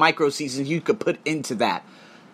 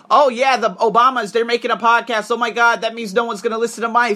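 high, excited mock fretting; hammed-up starstruck worry; mock panicked podcaster; 6 semitones higher, 4 dB louder than usual. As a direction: imitate someone fretting over famous competition. Mocks worry over Obamas' podcast.

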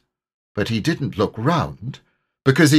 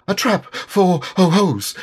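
husky Piglet; 10 semitones higher, 4 dB louder than usual. A narrator's reading. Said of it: "said Piglet huskily".

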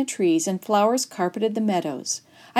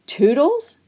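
higher, incredulous rising question; disbelief; 6 semitones higher, 5 dB louder than usual. A narrator's reading. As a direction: question the name as if you can't believe it. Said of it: "face registered disbelief".

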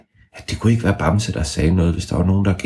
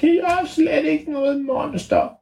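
very high squeaky creature voice; tiny high creature voice; ævle bævle voice. Acted. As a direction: squeak back like a cheeky hidden creature.

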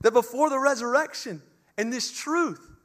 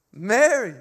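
calling her name; 2 semitones higher, 8 dB louder than usual. Acted. Jesus calls Mary by name.

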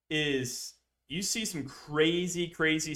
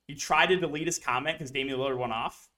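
ordinary talk, nothing marked

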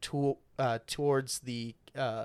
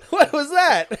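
high squeal of laughter into a louder question; high laugh then question; 8 dB louder, slower than usual. Real, not acted. Friend cracking up at the bad screech, laughing. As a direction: crack up in disbelief.

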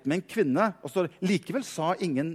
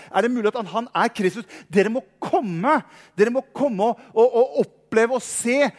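higher, louder, urgent plea to the crowd; excited woman; 6 semitones higher, 5 dB louder than usual. Retold or imitated. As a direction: beg them to come and see, thrilled. Woman urges the town to come.

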